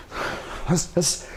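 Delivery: sharp hissed exhale on each punch; short, unvoiced "huss" bursts; strike breath noise. Shown as a demonstration. Coach showing punches to viewers, sharp breath noise. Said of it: "I make this really cool noise".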